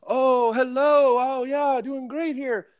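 high, drawn-out put-on phone voice; Billy Beane. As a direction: answer a pretend call in a slow, high voice. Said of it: "which voice you were doing for Billy".

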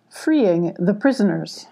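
plain reading voice

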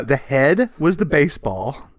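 ordinary talk

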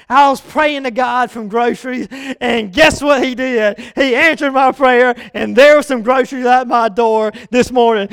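loud, thrilled good news; excited testimony. Acted. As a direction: proclaim the answered prayer, loudly. Woman reporting her answered prayer.

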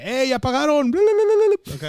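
very high, loud babbled cheer; squeaky bla bla cheer. Retold or imitated. Joking with coworkers about payday with a squeaky cheer. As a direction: squeal it out to celebrate. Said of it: "yo lo usaba desde antes en mi trabajo".

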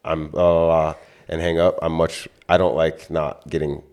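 ordinary delivery

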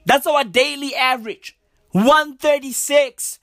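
ordinary talk, nothing marked

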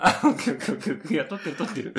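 loud, laughing protest. Real, not acted. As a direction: laugh through the words.

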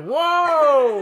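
high, loud startled whoa; surprised shout. Retold or imitated. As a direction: yelp it out in shock. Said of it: "then I was like".

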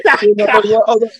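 mock despair about the mud, laughing; laughing retelling. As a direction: laugh through the complaint.

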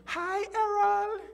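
very high, slow falsetto; imitated girl, drawn-out and high-pitched; girl's greeting. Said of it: "she came to me. She said".

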